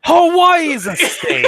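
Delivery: high, loud, drawn-out outburst; laughing shout. Real, not acted. Co-host protests about Hawaii, shouting and laughing. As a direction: blurt it loudly through laughter.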